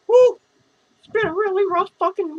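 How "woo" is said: loud, high whoop. Real, not acted. Whooping while drunk on camera.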